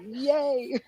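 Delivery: usual speaking voice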